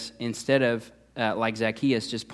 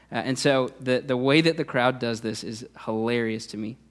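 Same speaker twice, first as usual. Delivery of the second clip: ordinary talk, nothing marked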